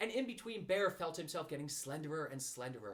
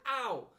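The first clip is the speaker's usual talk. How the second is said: pained ow; 8 semitones higher, 8 dB louder than usual. Acted. Being pulled hard.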